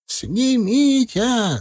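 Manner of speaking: high, drawn-out lament; wailing old man. A narrator's reading. Дед причитает, умоляя снять горшок, тягучим стоном. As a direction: wail like a suffering old man. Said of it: "запричитал дед".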